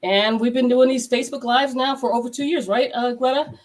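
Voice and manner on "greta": ordinary delivery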